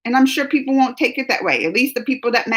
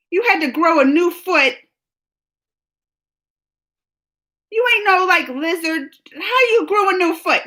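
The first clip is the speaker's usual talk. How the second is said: incredulous; 7 semitones higher than usual. Real, not acted. Teasing Doughboy's joke, incredulous.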